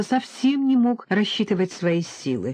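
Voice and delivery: ordinary delivery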